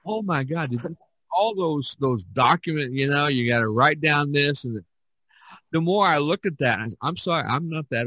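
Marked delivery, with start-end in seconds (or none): none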